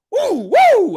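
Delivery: loud high double "woo"; whooping. Acted. Comic recalling stand-up shows, exuberant whooping.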